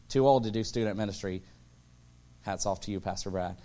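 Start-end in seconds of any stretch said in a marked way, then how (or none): none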